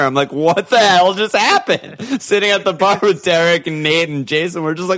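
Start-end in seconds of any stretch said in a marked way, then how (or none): 0.3-2.0 high, loud, slow outcry of shock; retold disbelief. Host re-enacts seeing the goal at the bar, retold disbelief. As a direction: cry out in stunned disbelief. Retelling stunned reaction to goal.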